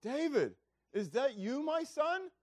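high calling voice to the hillside; a king calling out to his rival; crying out. Retold or imitated. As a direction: call out to find him across the distance. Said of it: "he's crying out".

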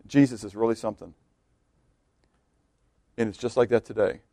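very soft, low warning from the pulpit; low, quiet preacher's aside; hushed preaching. Real, not acted. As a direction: drop right down and confide it gravely.

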